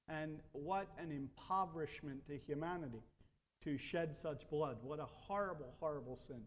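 low, hushed sorrow over a grievous sin; grave lament. Real, not acted. Preacher grieving bloodshed to his congregation, grave lament.

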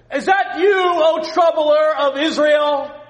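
huge, high, drawn-out shout; yell across a valley. Acted. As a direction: holler it to someone far across a valley. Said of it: "he'd yell across the valley".

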